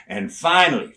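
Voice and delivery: ordinary talk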